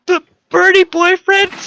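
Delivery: put-on high, embarrassed girl voice; flustered girl. Girl repeating the teasing nickname, high and flustered. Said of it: "The girl blushed".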